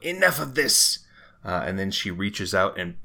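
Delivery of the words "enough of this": high, loud villain outburst; yelling out. Acted. Villain ending the haggling with players, exasperated yell. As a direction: yell it as if patience has run out. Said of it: "yells out".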